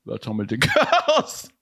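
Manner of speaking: slow, lightly voiced deadpan host; a hint of Hitchcock. Comedian finishing the Hitchcock bit with a slow deadpan. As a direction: keep it slow and dry like a film host. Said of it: "See how I did that".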